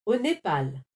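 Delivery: usual speaking voice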